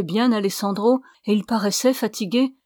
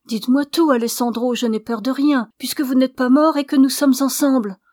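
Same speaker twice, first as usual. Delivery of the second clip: higher, louder impassioned woman's voice; urgent heroine; 4 semitones higher, 6 dB louder than usual. A narrator's reading. Ramona urging her beloved to tell all, urgent plea. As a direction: plead boldly, pitch and volume lifted. Ramona urges him to speak.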